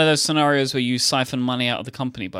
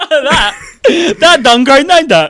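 loud, higher dopey bloke; 12 semitones higher, 14 dB louder, slower than usual. Pretend. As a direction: play a dim-witted bloke. Mock dumb grammar voice.

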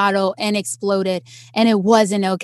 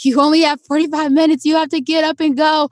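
raised, pressing instruction; urgent hurrying; 6 semitones higher, 3 dB louder than usual. Retold or imitated. Volunteers getting the crying kid moving, urgent. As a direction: imitate someone hustling a kid along.

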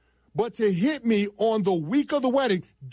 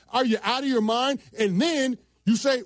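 ordinary talk

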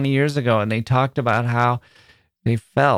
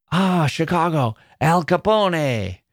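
higher, slow, relishing the name Al Capone; knowing Italian stranger; 6 semitones higher than usual. Retold or imitated. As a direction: savour the gangster cliché like a tourist-bait joke. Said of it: "Or they would say".

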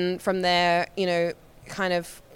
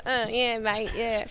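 mock Aussie; 3 semitones higher, 5 dB quieter, slower than usual. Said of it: "the hand thing".

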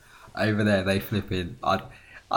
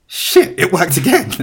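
loud, higher disbelief; mock astonishment; 7 semitones higher, 8 dB louder than usual. Man whose accent tactic succeeds twice, loud mock astonishment. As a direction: blurt it out in mock amazement. Acting out tactic working again.

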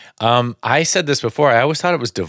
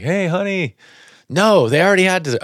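raised, high-pitched call to spouse; 10 semitones higher, 3 dB louder than usual. Retold or imitated. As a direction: imitate calling to someone on another floor. Calls between floors to spouse.

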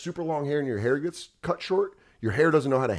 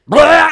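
loud high retching blurt; mock retching; 14 dB louder than usual. Dog vomiting in the back seat, loud high retch.

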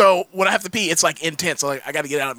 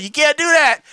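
shout; 8 semitones higher, 4 dB louder than usual. Retold or imitated. Clerk yells after him.

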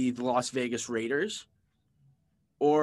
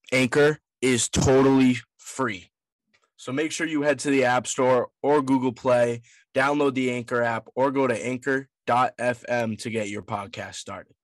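ad read; 5 dB louder than usual. Acted. Reads the sponsor ad.

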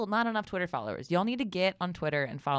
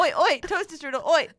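much higher, loud hammed-up Cockney accent; mock Cockney shout; 12 semitones higher, 8 dB louder than usual. Host escalates the bit with high Cockney shouting. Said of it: "ridiculous Cockney accent".

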